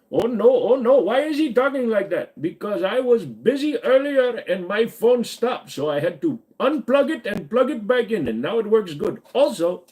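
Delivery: put-on high, lilting Indian accent. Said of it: "I can do the Indian accent".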